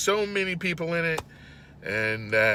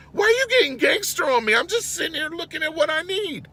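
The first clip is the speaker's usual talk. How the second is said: baffled; 12 semitones higher than usual. Retold or imitated. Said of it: "And I'm like".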